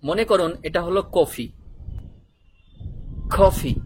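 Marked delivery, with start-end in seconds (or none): 3.4-3.8 কফি with a breath-puffed "kh" at the start; British-American pronunciation. Shown as a demonstration. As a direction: say কফি as "KHO-fi", punched louder. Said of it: "ওরা বলে কফি". (কফি-র শুরুতে জোরালো "খ" উচ্চারণ)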